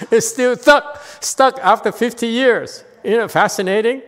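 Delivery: high, loud, tickled delivery; amused excitement. Real, not acted. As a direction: tease them, loud and high after a laugh. Amused teasing after laughing.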